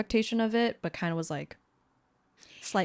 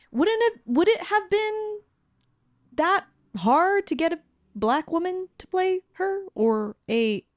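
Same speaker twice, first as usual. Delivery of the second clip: exasperated; 10 semitones higher than usual. Real, not acted. Exasperated rhetorical question.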